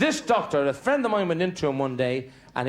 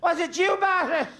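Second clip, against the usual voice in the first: granny's voice. Retold or imitated. Granny on the phone.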